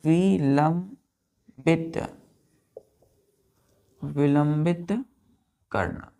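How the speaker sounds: विलंबित drawn out very slowly, syllable by syllable; विलंबित spoken very slowly with a rise; slow dictation. Shown as a demonstration. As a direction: stretch विलंबित slowly for students to write.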